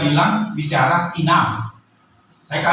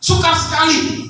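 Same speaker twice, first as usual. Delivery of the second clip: raised, driving sermon delivery; preaching at full force; 13 semitones higher than usual. Acted. Pastor urging the church not to complain, preaching hard. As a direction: press the congregation hard against complaining.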